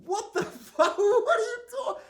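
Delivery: squeaky, loud laughing outburst; laughing disbelief. Real, not acted. Podcaster reacting to dragons killing dinosaurs, laughing. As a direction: crack up at an absurd idea.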